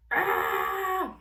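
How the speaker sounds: sharp, high cry of exasperation; loud exasperated shriek; frustrated yell. Pretend. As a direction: let out a burst of frustration. Toys dumped, parent snaps.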